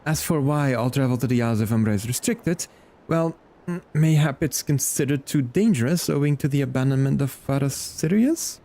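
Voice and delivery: hesitant, lightly acted scholarly reassurance; reassuring scholar. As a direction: play a formal scholar brushing off worries. Voices game character's conclusion.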